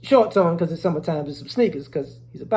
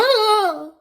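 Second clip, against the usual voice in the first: startled shriek; 13 semitones higher, 5 dB louder than usual. Retold or imitated. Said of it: "She's like".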